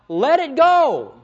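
high, loud, drawn-out plea; exasperated plea. Acted. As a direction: beg it loudly, stretching each word. Preacher mimics exasperated plea to self.

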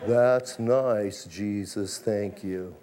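mock a stiff, unmoved churchgoer. Preacher mocking reserved Christians thanking Jesus, low dry mumble. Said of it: "like the reserved Christians".

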